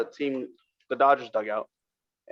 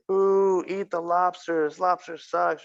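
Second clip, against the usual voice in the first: higher, drawn-out fan jeer; mock heckling; 5 semitones higher, much slower than usual. Giving the lobster girl shit from the stands, mock heckling. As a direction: imitate a booing ballpark crowd.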